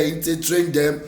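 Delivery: ordinary talk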